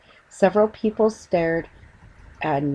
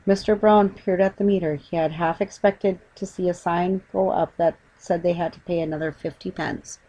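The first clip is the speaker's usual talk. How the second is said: ordinary delivery, nothing marked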